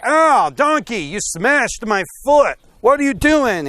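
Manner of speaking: high, loud acted exasperation; pained, angry scolding. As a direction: yelp and scold the donkey, hammed-up. Balaam's foot smashed, scolds donkey.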